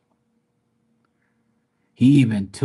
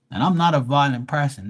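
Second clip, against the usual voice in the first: ordinary delivery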